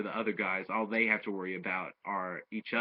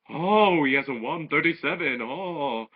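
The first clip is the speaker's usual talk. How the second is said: much higher and louder gasp of awe; mock amazement; 5 semitones higher, 7 dB louder than usual. Crowd marvels at Hercule's punching score, mock amazed. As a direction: ham up the crowd's wonder.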